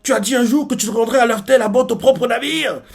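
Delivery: high-pitched indignant protest; acted cartoonish outrage; displeased. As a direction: demand an explanation, voice way up. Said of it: "Baggy pas content, il fait".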